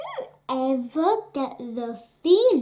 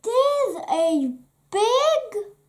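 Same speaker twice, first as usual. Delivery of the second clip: excited; 8 semitones higher, 4 dB louder, slower than usual. Acted. Daisy cut off excitedly.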